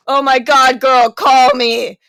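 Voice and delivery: loud, slow, dramatic panic; frantic texts read aloud. Retold or imitated. Friend reads out his urgent texts, loud and slow. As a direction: ham up the alarm in a friend's texts. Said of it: "texts from David like".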